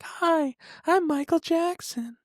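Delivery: imitating the star's high on-camera voice. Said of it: "acting half the time when he was talking like this".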